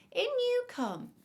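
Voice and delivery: high, loud, slow invitation; granny voice. A narrator's reading. Granny lets the visitor in, high and drawn-out. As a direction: sing out a slow welcome as Granny.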